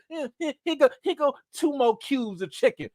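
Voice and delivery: mock grudging server; imitating staff attitude. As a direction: hand it over with a grudge. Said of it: "they always gotta give you an attitude".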